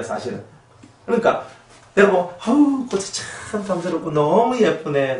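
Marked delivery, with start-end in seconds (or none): 2.4-5.2 높은 목소리로 감탄하며 칭찬해; 꽃을 쓰다듬듯 다정하게 감탄; 다정한 칭찬. Retold or imitated. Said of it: "쓰담쓰담 해주면서 이렇게 했단 말이에요". (꽃을 쓰다듬으며 높고 다정하게 칭찬)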